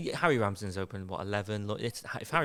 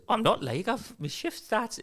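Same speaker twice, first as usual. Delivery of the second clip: high protesting voice; 12 semitones higher, slower than usual. Acted. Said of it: "And he goes".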